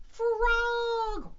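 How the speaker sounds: excited reveal. Acted. Revealing toy frog.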